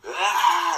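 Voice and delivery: short high loud mock scream; imitated scream. James screams as the faceless figure closes in, loud startled scream. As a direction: yell it as he bolts from the ghost. Retelling friend's scream at ghost.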